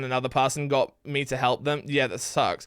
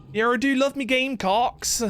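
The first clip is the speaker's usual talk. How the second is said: higher, lightly voiced West Country farmer; farmer accent; 7 semitones higher than usual. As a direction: play a cheerful yokel talking up his birds. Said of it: "putting my farmer accent on".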